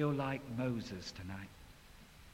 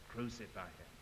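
much softer, low aside; friends' voice; 6 semitones lower, 13 dB quieter than usual. Retold or imitated. Friends pressuring, soft low aside. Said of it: "Voices of your friends saying".

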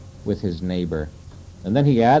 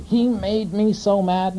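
whiny excuse; 11 semitones higher, slower than usual. Retold or imitated. Mocking an excuse for anger.

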